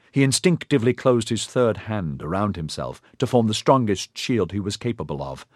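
ordinary delivery, nothing marked

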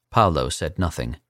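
usual speaking voice

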